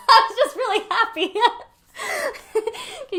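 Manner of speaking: laughing through the words, high and loud; giggling it out. Real, not acted. Laughing as she explains she was happy. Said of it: "laughing while telling".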